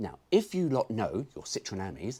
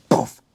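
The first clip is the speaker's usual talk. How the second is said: loud mock poof; 14 dB louder than usual. Kick sound effect.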